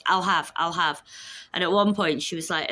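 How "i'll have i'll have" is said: mock rude customer. Mocking rude customers' ordering.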